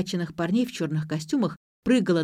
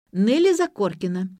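ordinary delivery

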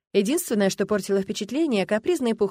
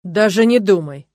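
slow, booming order; loud, commanding; 10 dB louder than usual. A narrator's reading. Dragon stops the youth from behind her, loud and commanding. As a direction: forbid it with full authority. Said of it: "прозвучал громкий властный голос".

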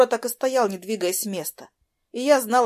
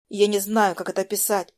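plain reading voice

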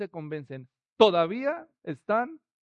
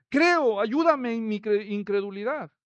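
father cries out; 3 semitones higher, 5 dB louder than usual. Acted. Desperate father crying out to Jesus, loud. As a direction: plead with everything you have. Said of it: "el padre del muchacho gritó".

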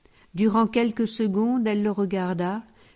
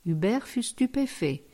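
ordinary delivery

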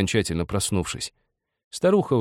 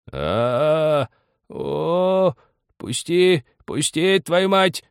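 high, drawn-out strangled cries; choking old man's yell; 10 semitones higher, 4 dB louder, much slower than usual. A narrator's reading. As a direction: cry out high as if suffocating. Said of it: "заорал, задыхаясь на печи старик".

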